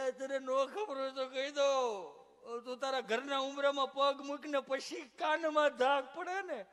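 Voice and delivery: doctor amused, loud and drawn out; mock explanation. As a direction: lay it out slowly for the fool. Doctor explains to patient, laughing.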